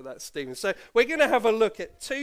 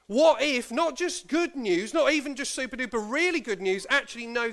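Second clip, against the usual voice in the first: higher, louder, eager build-up; excited; 5 semitones higher, 4 dB louder than usual. Acted. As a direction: whip up the kids' excitement. Hyping the children up.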